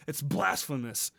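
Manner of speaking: ordinary delivery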